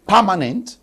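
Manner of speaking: "permanent" with open a vowels, as PAH-ma-nent; Nigerian pronunciation. Shown as a demonstration. Panelist showing how Nigeria says it, Nigerian pronunciation of "permanent". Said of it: "Maybe in Nigeria, they're going to say".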